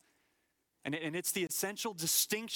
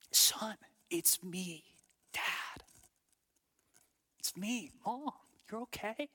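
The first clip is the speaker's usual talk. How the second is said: comforting parent; 6 semitones higher, slower than usual. Retold or imitated. A parent soothing a child after a nightmare, tender and slow. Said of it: "you go hold him".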